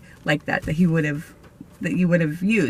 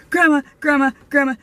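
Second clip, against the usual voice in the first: higher, louder urgent call for grandma; calling out urgently; 6 semitones higher, 3 dB louder, faster than usual. Retold or imitated. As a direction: call out for grandma, breathless with news.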